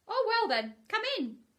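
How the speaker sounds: high, loud Rabbit voice. Acted. Rabbit letting Pooh in, high Rabbit voice. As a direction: invite him in grudgingly. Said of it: "Rabbit inviting Pooh".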